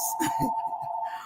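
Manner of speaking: quiet, voiceless laugh; brief breath of laughter; soft chuckle. Real, not acted. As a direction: chuckle softly to yourself. Amused by unwanted house buyers.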